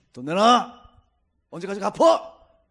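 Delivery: loud demand, slow on the second line; mock gangster threat. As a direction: bark at someone like a thug collecting money. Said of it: "다 이러잖아요".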